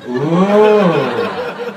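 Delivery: long, loud mock-crowd "ooh"; impressed "ooh". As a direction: draw out a loud, relishing ooh. Acting locals relishing a planned insult.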